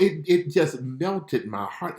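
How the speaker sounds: high, slow and tender; melted. Real, not acted. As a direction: let your heart melt on it.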